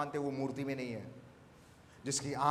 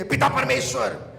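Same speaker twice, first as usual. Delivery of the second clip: raised voice for the congregation to repeat; leading a call-and-response; 5 semitones higher, 9 dB louder than usual. Shown as a demonstration. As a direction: call out the prayer line for them to echo. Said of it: "this is not the tone". (ऊँची आवाज़ में पुकार)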